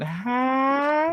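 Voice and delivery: long "hi" sliding way up high; imitated high greeting. Imitating how Kai went, high drawn-out squeal. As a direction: stretch it out into a rising, high-pitched mimicry. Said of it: "Kai went".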